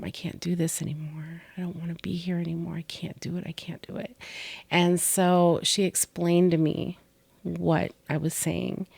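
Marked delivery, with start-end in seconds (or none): 0.0-4.2 unvoiced, fading pleading whisper; retelling her own hopeless pleas in a whisper; desperate whisper. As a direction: whisper it like you're giving up. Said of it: "You kept saying".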